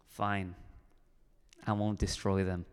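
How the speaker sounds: low, soft, reluctant giving-in; grudging concession. Acted. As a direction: give in grudgingly, voice dropped low. Acting God grudgingly giving in.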